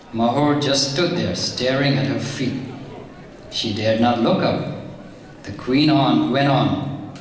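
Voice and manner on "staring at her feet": ordinary delivery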